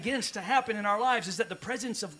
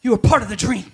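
much louder, punched on part; proclaimed; 3 semitones higher, 11 dB louder than usual. Acted. Pastor telling each listener they belong, loud proclaiming. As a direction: declare it to the crowd.

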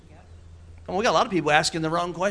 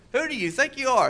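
mama's rebuke; 8 semitones higher than usual. Retold or imitated. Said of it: "my mama told me".

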